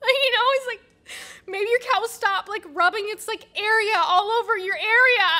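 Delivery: high, loud and crying through the words; tearful angry outburst. Acted. Annie at the support group, shouting through tears. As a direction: break into sobs while still ranting.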